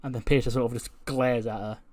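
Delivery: ordinary delivery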